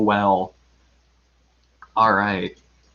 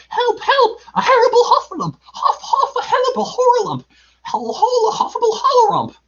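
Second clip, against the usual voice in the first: very high squealing, words tumbling into muddle; panicked Piglet babble. A narrator's reading. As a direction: cry out in frantic, tongue-tied terror. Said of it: "still crying out".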